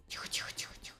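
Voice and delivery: breathy hushed whisper. Real, not acted. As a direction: whisper to calm it. Careful jump.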